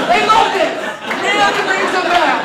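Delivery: high, loud hype; excited shout. Pretend. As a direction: yell it like a happy announcer. Mock announcer hype.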